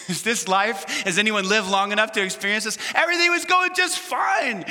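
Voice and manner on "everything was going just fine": mock cheerful. Preacher mimics sudden good times.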